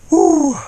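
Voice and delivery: sharp high hoot of cold; loud high cold whoop. Real, not acted. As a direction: let out a loud shivery "ouh". Freezing in cold water.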